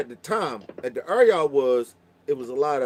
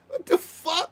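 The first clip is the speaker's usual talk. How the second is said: laughing disbelief; slower than usual. Retold or imitated. Told he's cute by a stranger, high laughing shout of disbelief. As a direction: burst out in amused shock.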